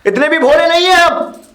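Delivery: loud, high, heated objection; indignant protest. Acted. Grandson hotly defending himself to his grandfather, indignant.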